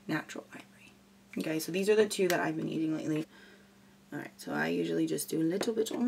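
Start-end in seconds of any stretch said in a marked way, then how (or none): 0.1-0.9 whispered aside. Real, not acted. Quiet aside while showing products.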